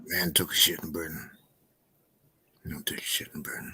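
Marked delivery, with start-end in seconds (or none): 2.7-3.7 whisper. Pretend. Whispered repeat of joke.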